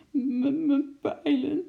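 high, halting sobby little voice; tearful sobbing. A narrator's reading. Henkie upset over his lost arrows, sobbing. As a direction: stammer it through a sob, pitched high. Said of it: "snikte hij opeens".

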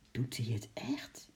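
hushed, breath-held whispered question; tense whisper. A narrator's reading. Henkie asking about the monkey, tense whisper. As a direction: whisper it in suspense. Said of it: "vroeg Henkie gespannen".

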